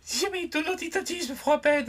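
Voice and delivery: high-pitched, hammed-up French mother; bad mum impression. Son imitates his French mum warning him off cheese, high-pitched mock scolding. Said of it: "That's a bad impression of my mum".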